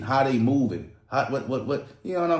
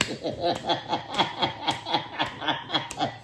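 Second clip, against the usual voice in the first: big laugh; 6 semitones higher than usual. Real, not acted. Laughing at contestant.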